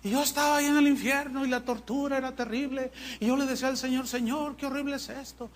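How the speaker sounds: high, quick mock testimony; young testimony giver. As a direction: imitate a breathless-paced tale of hell. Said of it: "testimonio de unos jóvenes".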